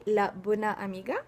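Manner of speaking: "la bona amiga" in Provençal, each vowel pronounced; Provençal pronunciation. Shown as a demonstration. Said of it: "pour redonner les mêmes exemples".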